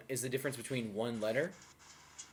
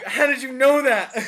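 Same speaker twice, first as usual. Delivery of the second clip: higher, louder, slow astonished question; amazed locals; 10 semitones higher, 10 dB louder than usual. Retold or imitated. Locals amazed the foreigner knows the insult, astonished. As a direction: act stunned and half-laughing.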